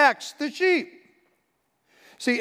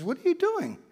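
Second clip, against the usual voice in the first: much higher, shocked question; startled question; 13 semitones higher than usual. Retold or imitated. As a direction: ask in disbelief. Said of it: "he says to the man".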